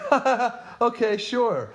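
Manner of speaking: high, loud fake laugh into a breezy brush-off; mock the queen laughing it off; mock dismissive laugh. Host as queen brushing off objections, mock dismissive.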